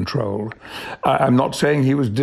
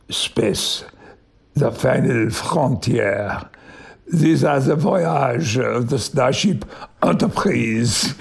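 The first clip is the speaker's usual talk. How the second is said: mock French accent; slower than usual. Shown as a demonstration. Recalling his French-accent audition for the interviewer, mock French accent. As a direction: recite the opening in a playful French accent, drawn out. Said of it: "that's how I did it".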